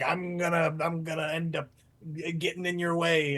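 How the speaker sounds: deep, halting dog voice; low-pitched, stumbling hero hound; heroic dog. Acted. Storyteller playing the dog blocking the fisherman, deep voice. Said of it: "he was like".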